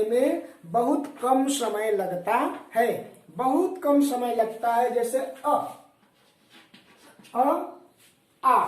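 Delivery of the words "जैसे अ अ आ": short अ then drawn-out आ; short and long vowel demo. Shown as a demonstration. Teacher contrasts vowel lengths for class. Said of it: "अ में ज्यादा समय लग रहा है कि आ में?".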